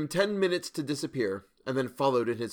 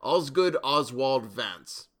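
slow, drawn-out roll call of a name; formal, measured announcement from a ledger; reading aloud; 3 dB louder, much slower than usual. A narrator's reading. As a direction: read the full name out with slow weight. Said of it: "he read aloud".

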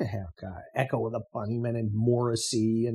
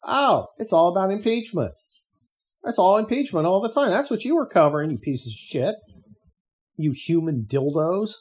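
angry rant; 7 semitones higher, 5 dB louder than usual. Real, not acted. Ranting at the media.

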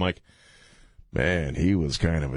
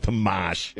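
put-on Boston accent. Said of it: "That's how he does a Boston accent".

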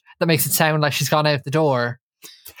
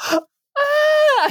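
joyful squeal. Retold or imitated. Aisling switching from panic to delight, high squeal. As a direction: squeal with sudden joy. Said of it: "to being like".

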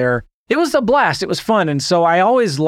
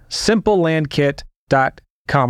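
slow, deliberate read of the address; slow, spelled-out address. Shown as a demonstration. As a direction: lay out simple land kit dot com word by word. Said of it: "Did I say it's free?".

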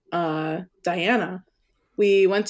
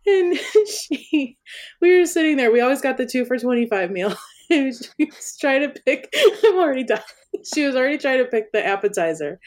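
giggling through the story; laughing hard while talking; 6 semitones higher, faster than usual. Real, not acted.

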